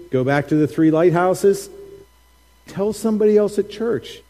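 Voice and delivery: ordinary talk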